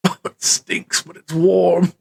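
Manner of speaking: put-on voice, first line half-whispered; grossed-out npc. GM voicing the npc as he drops into the sewer, grossed out. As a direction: wrinkle your nose at the smell, then shrug it off. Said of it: "And he goes".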